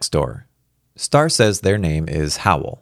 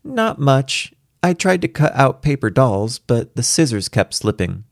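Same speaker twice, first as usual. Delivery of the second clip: put-on higher-pitched child's voice; little girl playing along; 7 semitones higher than usual. A narrator's reading. Daughter reporting on nursery school, higher and childlike. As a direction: play it straight as a small daughter. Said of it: "She played it straight back to me".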